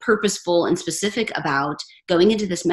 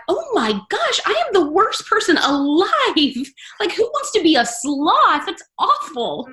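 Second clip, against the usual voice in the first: mock dismay; 9 semitones higher, slower than usual. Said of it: "I'm like".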